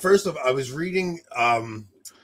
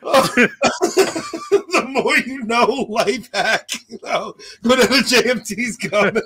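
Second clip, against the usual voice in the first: high, loud words breaking up with laughter; can't stop cracking up; helpless laughter; 9 semitones higher, 5 dB louder, slower than usual. Real, not acted. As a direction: talk through a real laughing fit. Still helplessly laughing at story.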